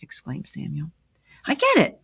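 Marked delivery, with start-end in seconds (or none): 1.5-2.0 higher, louder burst of delight; thrilled little "got it!" moment; happy exclamation. A narrator's reading. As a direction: exclaim it happily, pitched well up. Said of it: "exclaimed Amy happily".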